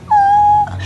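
high-pitched imitated swoon "aah"; mock a woman melting at sweet words; long, very high lovestruck "aah"; swooning woman's sigh. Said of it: "la mujer hace".

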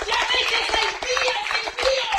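loud, high, driving sermon; preaching at full force. Acted. Preacher rebuking lukewarm believers, full-force preaching. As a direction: exhort them to come to church.

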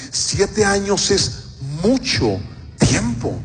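slow "Siete años es mucho tiempo" with pauses; emphatically drawn out. Real, not acted. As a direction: stretch "mucho" and "tiempo" apart. Stresses how long seven years is.

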